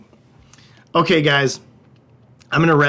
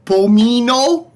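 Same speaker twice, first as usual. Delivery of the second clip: put-on slow, higher Wisconsin accent on Italian names; 8 semitones higher, 5 dB louder, slower than usual. Viewer request, Italian regions in slow hammy Wisconsin accent. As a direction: stretch each town in a goofy Wisconsin drawl. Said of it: "with a Wisconsin accent".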